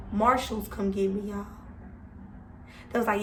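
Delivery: ordinary talk, nothing marked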